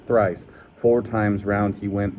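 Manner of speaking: ordinary delivery, nothing marked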